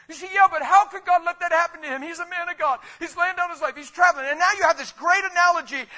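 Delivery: loud impassioned preacher; preaching at full force. Acted. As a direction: build it like a revival sermon.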